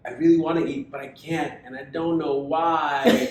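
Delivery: high, drawn-out put-on pout; mock her drama, slow and whiny; hammed-up sad complaint, slow at the end; dramatic whine. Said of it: "putting on the drama".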